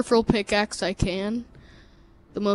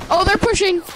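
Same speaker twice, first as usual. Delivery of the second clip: urgent shout, pitched up high; alarmed callout; 9 semitones higher, 5 dB louder than usual. Real, not acted. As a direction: warn your teammate fast. Enemies pushing, alarmed callout.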